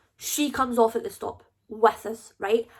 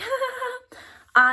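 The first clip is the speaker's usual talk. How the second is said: mock giggle; 13 semitones higher, slower than usual. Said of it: "like, giggling".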